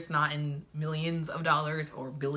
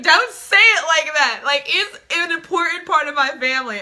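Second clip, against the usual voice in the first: mock offended protest; 8 semitones higher, 9 dB louder than usual. Heroine defending her dog as family, theatrically offended. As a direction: protest loudly, hammed up.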